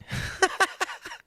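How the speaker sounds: high, breathy laugh. Real, not acted. Host realizing his mistake on air, high laugh. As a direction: laugh at your own slip.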